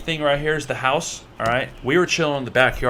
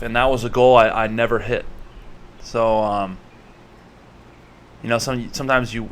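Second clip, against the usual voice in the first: normal talking voice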